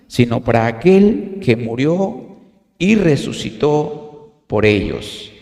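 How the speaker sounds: slow, measured Bible reading; scripture reading. Acted. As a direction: read the passage with solemn weight. Reads the Philippians passage aloud.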